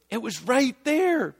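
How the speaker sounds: high-pitched, astonished dawning; acted-out wide-eyed amazement; amazed realisation. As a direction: marvel at what you missed, voice lifted high. Acting out students' amazed realisation.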